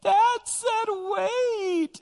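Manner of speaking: put-on drawn-out high child's complaint; whiny toddler. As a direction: wail it like a two-year-old tattling to mom. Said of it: "He'll run to his mom and go".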